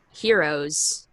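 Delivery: ordinary talk, nothing marked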